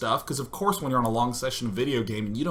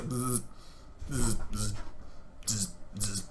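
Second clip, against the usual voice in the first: short, soft insect buzzes; quiet little bursts of buzzing; freaky wasp noises; 6 semitones lower, 10 dB quieter, slower than usual. Retold or imitated. Imitating the wasp on the wall, freaky wasp noises. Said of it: "just making freaky noises".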